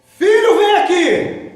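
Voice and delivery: loud, high call for his son; father yelling. Retold or imitated. Father discovering the messed tapes, loud angry call. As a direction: bellow for the boy from the other room. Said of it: "E ele já gritou logo".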